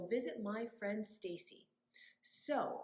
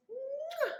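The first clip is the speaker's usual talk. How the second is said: playful kiss sound. Pretend. Blowing kiss to kids.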